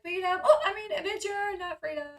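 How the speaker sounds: high, playful imitation of a slip; amused, high-pitched mock cover-up; joking backtrack. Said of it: "so funny that she's like".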